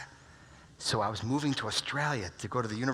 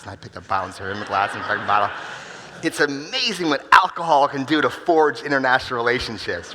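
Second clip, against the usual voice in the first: faster then much louder and higher into a big comic punchline; rising comic excitement; 4 semitones higher, 13 dB louder than usual. Acted. Telling the crowd about drinking sake, building to a loud joke. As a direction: build from quick patter to a booming joke.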